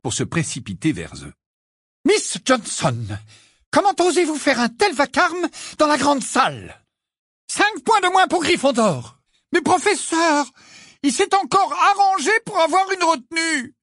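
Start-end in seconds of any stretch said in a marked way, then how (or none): 2.0-9.2 high, loud, stern; a strict teacher rebuking; McGonagall scolding. A narrator's reading. McGonagall voiced. 9.5-13.8 high, loud protest; shouting. A narrator's reading. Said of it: "se mit à crier si fort".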